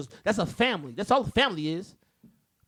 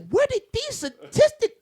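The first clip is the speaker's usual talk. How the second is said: disbelieving yelp at the numbers; incredulous outburst; 6 dB louder, slower than usual. Retold or imitated. Venting to friends about statistics, incredulous yell. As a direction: voice shoots up high, ending in a shouted what.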